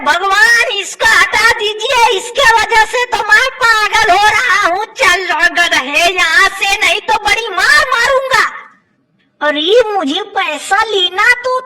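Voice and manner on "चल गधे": ordinary talk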